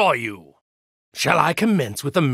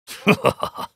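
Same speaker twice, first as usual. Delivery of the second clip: mocking laugh; 4 semitones higher than usual. Laugh in battle.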